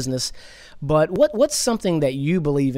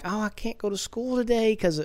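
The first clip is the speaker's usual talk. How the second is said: higher, lightly mocking complaint; mocking student; 7 semitones higher, 4 dB quieter than usual. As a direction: mimic a student skipping class over the election. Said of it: "and they're like".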